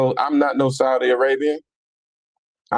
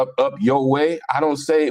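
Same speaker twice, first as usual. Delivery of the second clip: ordinary talk, nothing marked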